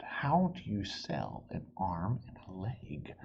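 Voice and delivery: soft, slow whispered thought; thinking aloud. Acted. Amelia Bedelia puzzling silently over her mother's words, whispered. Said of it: "Amelia thought".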